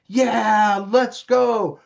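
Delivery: loud, high, gung-ho cheer; eager young people. Acted. Young people in the tribe volunteering, loud excited cheer. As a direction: shout it like charging off to adventure.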